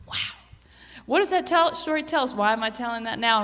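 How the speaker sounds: usual speaking voice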